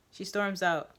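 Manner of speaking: usual speaking voice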